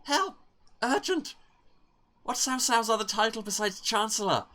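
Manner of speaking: high, loud frantic cry for help; panicked plea. Acted. Student texting for exam answers, loud and frantic. Panicked text voiced aloud.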